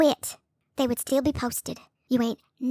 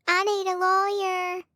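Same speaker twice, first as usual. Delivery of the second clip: mocking whine; 6 semitones higher, slower than usual. Mocking his cry for lawyer.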